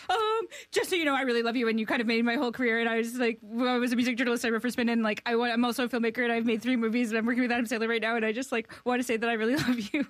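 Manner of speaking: high, fast, breathless rush of praise; nervous fangirl gush. Retold or imitated. Fan pouring her heart out to her idol, rushed and high. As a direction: blurt it all out before the chance is gone. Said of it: "So I was like".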